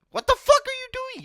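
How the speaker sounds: high-pitched, loud indignant yelp; pissed inner outburst. Retold or imitated. Grandson silently protesting grandma's fasting order, pissed outburst. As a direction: blurt the outrage you held back. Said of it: "But in my head ... I'm pissed".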